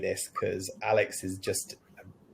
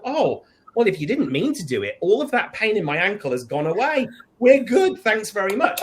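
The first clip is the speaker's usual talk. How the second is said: much higher, louder, fake-breezy gushing ending in a laugh; mock sarcastic forgiveness; 8 semitones higher, 4 dB louder than usual. Shopper mocking the stranger's excuse, sarcastic and gushing. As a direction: ham up an absurdly cheery all-is-forgiven.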